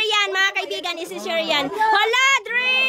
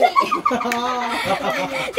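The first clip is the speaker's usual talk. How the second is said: laugh; 7 semitones higher than usual. Real, not acted. Laughing while opening box.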